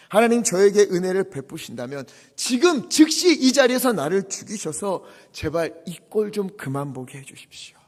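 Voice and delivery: ordinary talk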